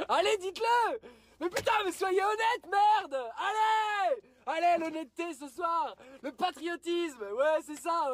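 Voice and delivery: high, exasperated shouting rant; indignant, high-pitched outburst; angry demand. Real, not acted. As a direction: demand honesty at the top of your voice. Rants at supermarkets to be honest.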